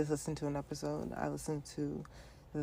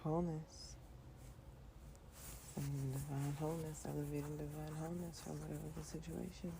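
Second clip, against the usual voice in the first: soft affirmation; 10 dB quieter, faster than usual. Real, not acted. Host closing with a quiet affirmation of wholeness, meditative. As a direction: breathe the words out softly like a mantra.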